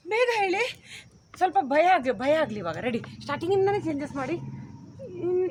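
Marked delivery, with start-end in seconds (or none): 0.1-0.9 higher, rising delivery; corrected voice. Shown as a demonstration. Director shows the actor the line, raised urging voice. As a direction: lift the pitch and end on a rise. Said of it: "ಆ voice ಅಲ್ಲ".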